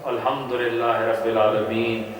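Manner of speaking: slow, measured Quranic recitation; slow recited Arabic, drawn out; retold prayer recitation. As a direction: recite it slowly as if leading prayer. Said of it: "قرات شروع کر دی".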